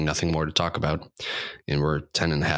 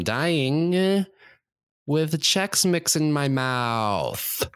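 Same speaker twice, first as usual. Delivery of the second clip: blissful dying; 10 semitones higher, much slower than usual. Acted. Dying son ending the bit in mock rapture.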